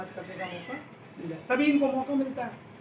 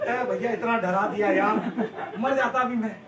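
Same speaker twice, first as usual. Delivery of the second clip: louder, laughing through the words; relieved laughing; 7 dB louder than usual. Retold or imitated. Friend telling the narrator he nearly died of fright, laughing loudly. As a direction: laugh off the scare, still shaken.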